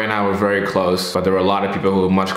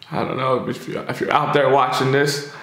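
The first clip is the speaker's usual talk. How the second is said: real tears breaking in, breathy and higher; starting to cry; 5 semitones higher than usual. Real, not acted. Speaking to late friend Corey, starting to cry. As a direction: voice crumbling as he talks to his friend. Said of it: "swear I wasn't gonna cry".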